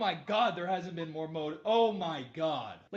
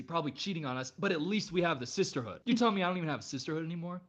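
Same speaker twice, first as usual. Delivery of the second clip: normal talking voice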